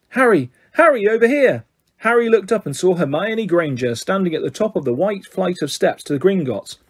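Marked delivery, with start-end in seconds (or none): 0.1-1.6 calling out. Acted. Hermione calling across the street.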